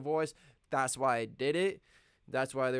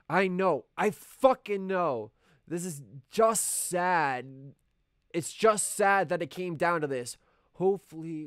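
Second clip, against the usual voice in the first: emotional outburst; 5 semitones higher, 7 dB louder than usual. Acted. Breaking point, emotional outburst.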